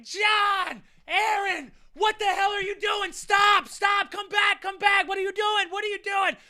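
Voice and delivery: put-on a mother shouting at fighting kids to stop; mom yelling. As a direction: yell across the field like a frantic mom. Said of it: "she's like".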